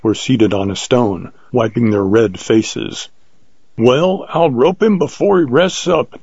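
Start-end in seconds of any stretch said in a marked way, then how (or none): 3.8-6.2 higher-pitched character declaring his plan; lightly voiced declaration. A narrator's reading. Hunter declares he will rope the lion, lightly acted declaration. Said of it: "declared Jones".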